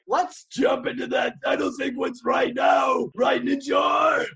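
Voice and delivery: slow, loud, high heroic shout; bold swashbuckling bravado. Acted. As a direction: announce it like a caped hero. Hammy show intro in character.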